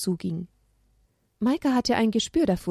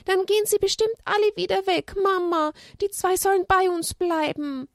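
put-on high pleading child; high childish plea; upset child; 12 semitones higher than usual. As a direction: beg mum to keep them. Esther pleading.

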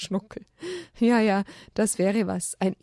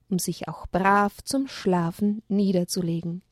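ordinary delivery

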